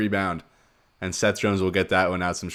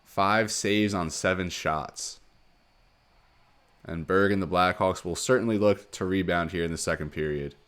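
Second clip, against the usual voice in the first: normal talking voice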